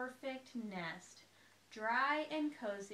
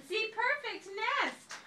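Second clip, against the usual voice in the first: high, loud, thrilled; high excited duck voice; high delight; 12 semitones higher, 7 dB louder than usual. A narrator's reading. Duck admires nest.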